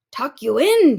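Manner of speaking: loud, indignant pirate bellow. A narrator's reading. Captain scoffs at the boy's bedtime wish, loud bellow. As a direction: bellow it, offended. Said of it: "he bellowed".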